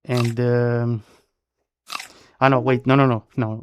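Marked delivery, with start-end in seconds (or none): none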